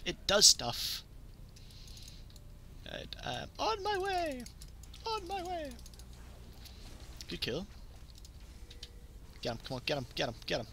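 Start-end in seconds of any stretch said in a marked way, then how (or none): 3.6-5.7 high sing-out call; high-pitched, playful announcement. Pretend. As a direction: pipe it up high, well above your usual voice. Calling out in a game.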